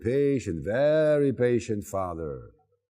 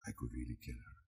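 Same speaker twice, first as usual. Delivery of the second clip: much softer, lower, half to himself; very soft, low aside; under breath; 12 semitones lower, 21 dB quieter, faster than usual. Real, not acted. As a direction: drop to a near-mutter. Confessed exasperation, dropped voice.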